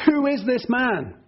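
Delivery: high, drawn-out awed question; amazed question. Acted. Disciples wondering at Jesus who calms the storm, amazed. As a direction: ask it slowly, pitched well up, in wonder.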